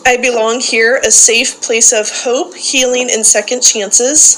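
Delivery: loud call-and-response recitation, each line declared for the group to repeat; loud group-leader recitation; leading a creed. Acted. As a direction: proclaim it to the room like a rallying creed. Leads daily creed recitation.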